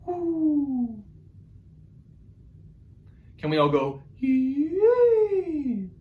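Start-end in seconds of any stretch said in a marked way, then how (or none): 0.0-1.0 Mama Owl hoo. Shown as a demonstration. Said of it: "Mama Owl has a beautiful singing voice". 4.2-5.9 Mama Owl yee. Shown as a demonstration. Teacher models Mama Owl for the class, high sung yee. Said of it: "Mama Owl has a beautiful singing voice".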